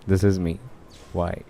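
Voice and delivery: normal talking voice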